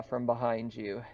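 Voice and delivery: ordinary talk, nothing marked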